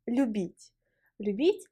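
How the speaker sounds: ordinary talk, nothing marked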